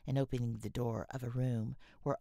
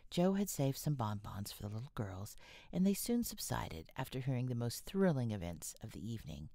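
usual speaking voice

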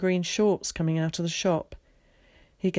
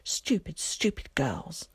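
angry thought; 4 dB quieter, slower than usual. A narrator's reading. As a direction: mutter crossly to yourself. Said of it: "he thought angrily to himself".

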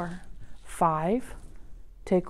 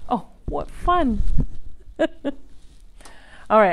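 normal talking voice

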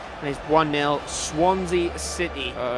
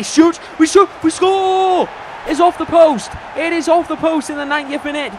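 excited shout; 10 semitones higher, 6 dB louder than usual. Real, not acted. Goal call in match highlights.

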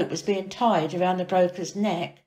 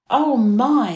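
higher, louder gasp of shock; doctor startled on the dark stairs; startled cry; 5 semitones higher, 6 dB louder than usual. A narrator's reading. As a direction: recoil at the discovery. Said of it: "he cried".

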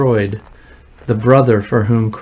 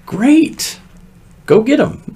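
eager Pharaoh; 13 semitones higher, 3 dB louder, slower than usual. Acted. Pharaoh's reaction voiced.

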